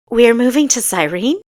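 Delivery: loud, rising disbelief; incredulous blurt. Acted. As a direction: blurt it out in shock. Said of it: "Kessa blurted incredulously".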